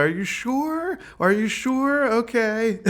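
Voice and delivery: mimicking own worried double-check; doubtful self. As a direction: ask twice as if you can't quite believe her. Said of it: "I'm like".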